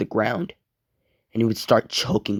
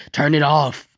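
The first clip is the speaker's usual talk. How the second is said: louder, higher mock yell; restrained storyteller's scream for it to stop; yelling plea; 5 semitones higher, 5 dB louder than usual. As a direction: shout it like someone on fire. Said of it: "he starts screaming and yelling".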